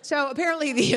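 normal talking voice

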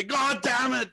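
high, slow angry shout; imitating a furious roommate yelling. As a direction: throw everyone out of the room. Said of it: "he starts yelling at everybody".

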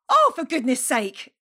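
loud, slow exasperated sigh of a line; exasperated outburst. Retold or imitated. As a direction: let the irritation boil over. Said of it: "felt it bubbling up inside me".

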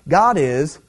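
ordinary talk

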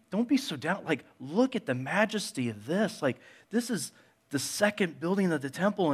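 high, eager, gushing praise of the temple; excited disciples. Retold or imitated. Disciples trying to lift Jesus' mood, high and gushing. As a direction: cheer Jesus up with wide-eyed enthusiasm. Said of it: "the disciples are like".

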